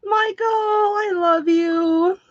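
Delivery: high, drawn-out shout of delight; excited cry. Real, not acted. Fan shouting thrilled love to generous viewer Michael, high and drawn out.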